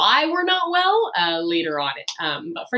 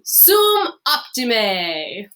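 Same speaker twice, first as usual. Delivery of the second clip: an eager, drawn-out sum optime; with enthusiasm; 4 semitones higher, 5 dB louder, much slower than usual. Shown as a demonstration. Teacher showing students an enthusiastic sum optime. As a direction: sell sum optime like great news. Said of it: "You wanna say it with some enthusiasm".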